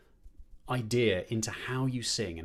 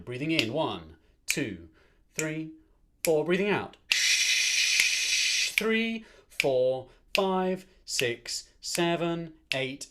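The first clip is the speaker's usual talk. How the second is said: counting the breath; 3 semitones higher, 4 dB louder, much slower than usual. Shown as a demonstration. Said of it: "Breathing into four, out to eight".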